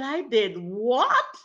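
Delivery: high, loud, drawn-out question; acted stunned exclamation; shocked disbelief. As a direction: blurt it in disbelief, stretching the final word.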